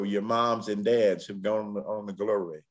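normal talking voice

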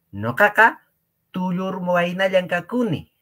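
high put-on voice of a thin youth; acting a young man. Teacher voicing a young man in a song, high. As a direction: play the young man boasting. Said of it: "Yo soy un joven delgado, flaco".

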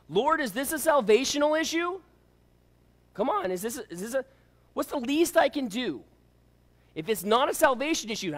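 high, loud, hammed-up haggling; mock bargaining. Believer haggles with the Lord over effort, mock pleading.

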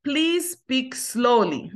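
very slow, words stretched apart; slow model phrase. Shown as a demonstration. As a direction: model it slowly for learners. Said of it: "si m ap pale twò vit".

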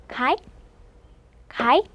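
"카이" as one isolated syllable; aspirated k, gliding to a stopped end in "카이"; teacher's repeated model of "카이"; demonstrating "카이". Shown as a demonstration. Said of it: "이렇게요".

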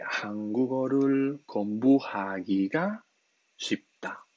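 careful Korean pronunciation of 한국어를 공부하기가 쉽다; enunciated Korean phrase; Korean model sentence. Shown as a demonstration. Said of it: "diríamos".

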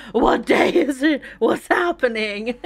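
slow, dazed just-woken questions; confused waking up. Acted. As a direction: act lost after oversleeping. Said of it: "That's how you wake up".

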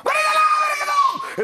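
very high, shrieking shout from the balconies; shrieking mothers. Acted. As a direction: scream like furious mothers on balconies. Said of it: "De moeders die roepen".

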